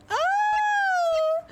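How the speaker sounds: high drawn-out whimpering "oh"; emotional wail. Real, not acted. Emotional wail, says she's crying.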